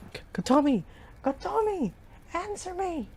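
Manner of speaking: high, frantic calls for her; stammered panicked yelling; desperate shouts. Acted. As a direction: call out desperately through the flames. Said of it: "my desperate shouts".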